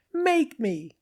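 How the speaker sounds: high, cheeky little-machine taunt; squeaky machine voice. A narrator's reading. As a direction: sneer it in a tinny high voice.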